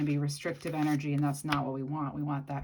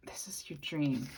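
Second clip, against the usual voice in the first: whisper; 4 dB quieter than usual. Acted. Intimate tarot message to viewer.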